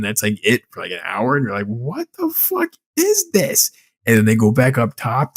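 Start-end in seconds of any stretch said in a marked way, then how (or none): 1.7-3.7 slow, very high comic disbelief; incredulous squeal. Retold or imitated. Friend retelling an hour without sharks, incredulous squeal. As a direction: draw it out in squeaky mock bewilderment. Repeated baffled reaction to film.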